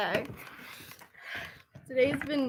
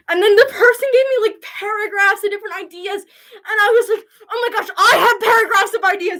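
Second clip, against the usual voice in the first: high, loud, bubbling over with joy; excited gushing; 6 semitones higher, 7 dB louder than usual. Real, not acted. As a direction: gush like a thrilled fan. Gushing about fan ideas.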